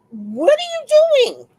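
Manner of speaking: high, loud, drawn-out confrontation; pretend challenge. Woman imagining facing an intruder with a rifle, loud challenge. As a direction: call it out slow and high, hammed-up.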